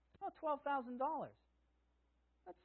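ordinary talk

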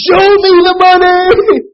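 full scream. Retold or imitated. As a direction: scream it with everything you've got.

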